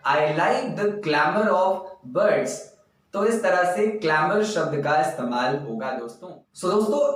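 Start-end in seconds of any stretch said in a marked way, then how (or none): 0.1-2.5 slow, spaced-out English example; slow example sentence. Shown as a demonstration. Teacher dictates an example sentence to students, slowly. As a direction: dictate it word by word. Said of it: "मुझे पक्षियों का शोरगुल".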